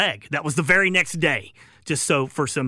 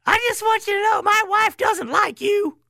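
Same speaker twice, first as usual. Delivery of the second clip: Joe Paterno impression; 3 dB louder than usual. Host imitating the old coach needling a reporter, high squeaky voice. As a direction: mimic the old coach's thin, high, deadpan put-down. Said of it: "that was Joe's response to it".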